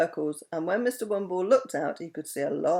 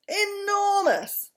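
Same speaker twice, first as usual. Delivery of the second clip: enormous; 14 semitones higher, 4 dB louder, much slower than usual. A narrator's reading. Aeroplane man describes the dragon.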